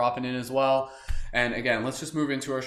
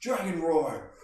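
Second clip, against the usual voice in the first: dragon roar; 6 semitones higher, slower than usual. Shown as a demonstration. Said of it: "follow my lead".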